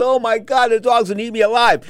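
high-pitched retold alarm; mock panic. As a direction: act out panic under attack. Panicked thoughts as dog attacks.

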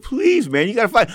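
high-pitched urgent begging, retold; pleading. Begging the boxer to fight.

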